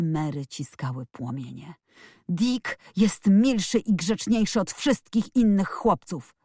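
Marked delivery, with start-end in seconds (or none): 2.3-6.3 slow, higher, emphatic defence; indignant emphatic exclamation; calling out. A narrator's reading. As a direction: declare it word by word, voice raised. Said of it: "zawołała".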